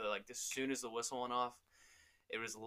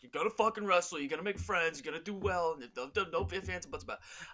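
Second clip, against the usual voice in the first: louder, higher pushy dad orders; imitating dad barking orders; bossy dad; 7 semitones higher, 5 dB louder than usual. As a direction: lay down the law like a gruff father. Dad forcing son to wrestle.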